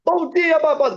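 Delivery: high, bright-eyed flirty hello in her voice; sweet greeting. Retold or imitated. Flight attendant greeting the pilot, high and sweet.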